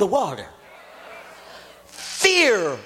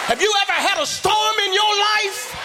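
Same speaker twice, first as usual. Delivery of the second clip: preacher shouting a question to the church; calling to church; 9 semitones higher than usual. Real, not acted. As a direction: rouse the room with it. Preacher calls out to congregation.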